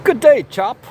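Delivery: high, loud mock-English greeting; bad English accent. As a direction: put on a hammy English accent. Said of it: "I cannot do an English accent".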